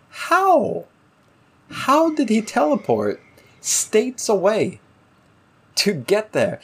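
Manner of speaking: high, disbelieving how, slowing on states away; incredulous. Real, not acted. Podcaster baffled by plot teleport, incredulous.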